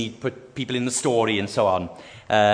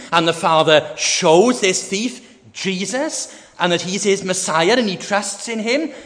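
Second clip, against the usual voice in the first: rising, raised preacher voice building to a peak; preaching at full force; 6 semitones higher, 3 dB louder than usual. Acted. Preacher proclaiming the Father's forgiveness to the congregation, building. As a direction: preach it with mounting conviction.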